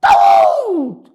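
high, drawn-out yowl; shouting cat. Acted. Teacher mimics a cat that cries Davut, high yowling shout. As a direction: yowl the name loudly like a cat. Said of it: "Davut diye bağıran kedi".

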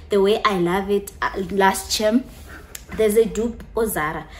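usual speaking voice

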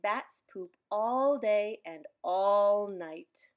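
usual speaking voice

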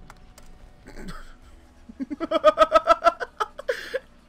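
high, loud burst of laughter; cracking up. Real, not acted. Laughs at his own mockery.

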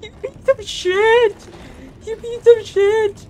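drawn-out, high-pitched cursing in panic; high, stretched-out panicked cry; high panicked swearing. Real, not acted. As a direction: wail each word out slowly, high and frantic.

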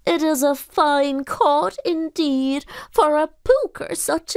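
high, slow creature voice; gratefully proud donkey spirit; pooka voice. Acted. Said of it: "and said".

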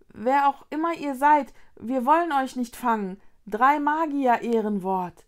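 plain reading voice

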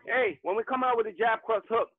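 ordinary talk, nothing marked

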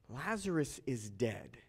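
low, slow and soft; plain, grave statement. Acted. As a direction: state it plainly, quietly, letting it sink in. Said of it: "Jesus told them plainly".

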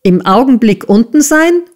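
high, jeering question; sneering repeat, rising at the end; mocking echo. A narrator's reading. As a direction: throw the words back with scorn. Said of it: "höhnte Squeers".

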